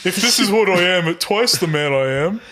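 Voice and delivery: ordinary talk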